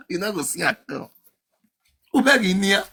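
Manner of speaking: usual speaking voice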